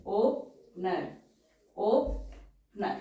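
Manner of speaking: Opener stretched very slowly and softly; slow and syllabled. Shown as a demonstration.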